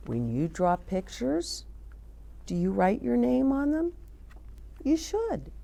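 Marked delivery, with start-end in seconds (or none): none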